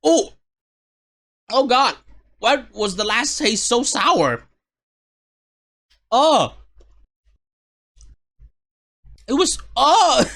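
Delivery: high, loud shocked cries; shocked yelp. Real, not acted. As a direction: cry out at the sour taste. Sour candy hit hard.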